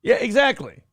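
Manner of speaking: usual speaking voice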